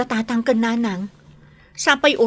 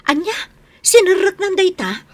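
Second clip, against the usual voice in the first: shocked disbelief; 10 semitones higher, 4 dB louder than usual. Acted. Shocked at drug pusher news.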